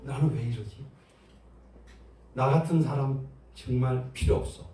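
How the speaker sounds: low, muted self-blame; self-reproach. Acted. Preacher acts someone blaming themselves after sinning, low self-reproach. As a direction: grumble at yourself, understated. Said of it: "자책이야".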